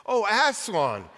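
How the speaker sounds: high, slow joyful cry; cried out. A narrator's reading. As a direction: call his name in relief and joy. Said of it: "cried Lucy".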